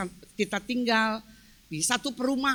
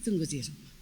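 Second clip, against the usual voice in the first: low, softer stern echo; retold fierce order; 10 semitones lower, 6 dB quieter, faster than usual. Husband ordering his wife home, low, quiet sternness. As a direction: quietly imitate a menacing command. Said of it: "Wah, galak".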